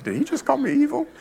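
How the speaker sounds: high, indignant mock listener; mock offended listener. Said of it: "You're like".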